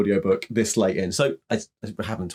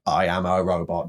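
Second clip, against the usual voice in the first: flat, lower robotic monotone; 5 semitones lower than usual. Retold or imitated.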